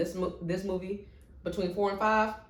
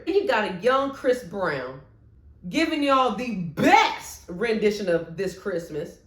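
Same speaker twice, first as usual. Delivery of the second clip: usual speaking voice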